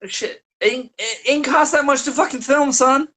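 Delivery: incredulous scoff. Real, not acted. Scoffing at the filming-cost excuse.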